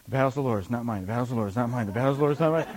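low, soft, timid chant; mock gentle. Preacher imitating David gently coming up to fight, meekly. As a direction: repeat it meekly like a nervous self-reassurance. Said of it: "just kinda gently coming up".

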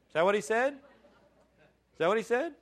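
high, loud questioning, slowing on the repeat; mock incredulous. Preacher testing the congregation on a misquote, mock incredulous. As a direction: challenge the listeners with a high-pitched, disbelieving question.

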